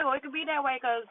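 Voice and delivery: usual speaking voice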